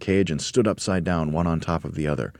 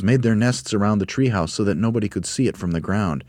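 ordinary delivery, nothing marked

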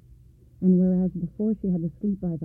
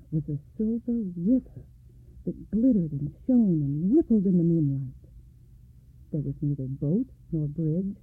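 plain reading voice

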